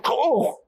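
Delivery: high startled cry; yelp, falling; a sudden squeal; yelp as she trips. A narrator's reading. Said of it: "she tripped, and she fell".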